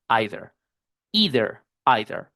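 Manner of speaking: either alternated between eye-ther and ee-ther; either said both ways; alternate pronunciations. Shown as a demonstration. Teacher contrasts the two valid versions of either. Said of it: "yo veo gente que dice either".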